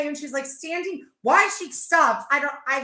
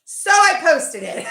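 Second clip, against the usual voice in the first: laughing; 7 semitones higher, 8 dB louder, slower than usual. Real, not acted. Laughing punchline.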